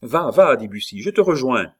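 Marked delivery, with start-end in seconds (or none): 0.0-0.5 loud, high send-off; pressing raised shout; urgent command. A narrator's reading. Urging escape amid a fight. 1.0-1.7 high, loud call; raised, hurried-feeling assurance; urgent promise. A narrator's reading. Promise called out amid fight.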